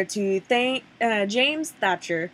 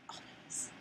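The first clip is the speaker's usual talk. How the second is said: whisper; 29 dB quieter than usual. Acted. Sign-off callback to brand name.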